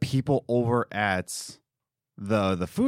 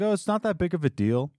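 higher, quick dismissive mock boss; mocking the owner; 7 semitones higher, faster than usual. Mocking the owner shrugging it off, higher and quick. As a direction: brush it off like he doesn't care. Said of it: "And he's like".

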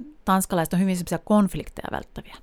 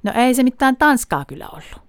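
put-on arguing child; 5 semitones higher, 5 dB louder than usual. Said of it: "ne alkaa niinku vänkäämään siitä".

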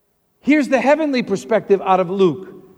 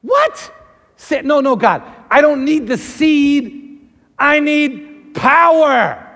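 high, loud objection to God; acted indignant pleading; incredulous protest; 6 semitones higher, 6 dB louder, slower than usual. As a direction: protest loudly, stretching out the demand.

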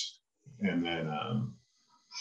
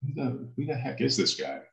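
retold angry outburst; 7 semitones higher, 3 dB quieter than usual. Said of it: "I'm getting angry".

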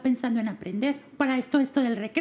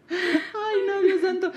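laughing dismay; 5 semitones higher, much slower than usual. Real, not acted. Mock horror while laughing.

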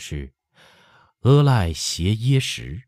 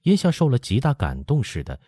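ordinary delivery, nothing marked

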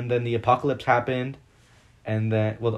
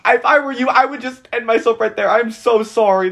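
dramatic wail; 11 semitones higher, 9 dB louder than usual. Retold or imitated. Mock advice to the character.

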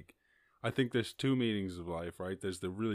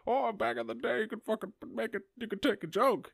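much higher whiny mock voice; mocking complainers; 13 semitones higher, 4 dB louder than usual. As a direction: parody nostalgic grumblers. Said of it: "People always been going on about".

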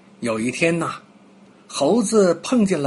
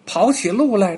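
ordinary delivery

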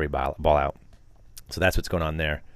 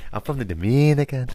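higher mock Dominican accent; Dominican skit accent; 5 semitones higher than usual. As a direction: imitate the sketch's Dominican accent. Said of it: "you're gonna reenact it?".

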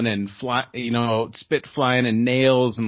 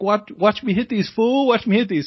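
high, excited shout out a window; imitating a buddy's cocky yell; retold yell; 9 semitones higher, 4 dB louder than usual. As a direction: call out to show off, pitched well up. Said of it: "he yelled out the window".

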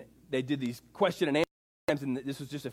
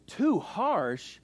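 indignant retort, higher than usual; gruff retort; 7 semitones higher, 4 dB louder than usual. Retold or imitated. Old theologian answering a conference question, gruff. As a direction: throw it back in mock disbelief. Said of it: "a little gruff".

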